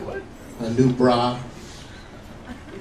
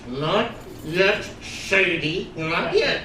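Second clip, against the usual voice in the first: holding her off; 4 semitones higher, much slower than usual. Acted. Husband holding wife off while busy.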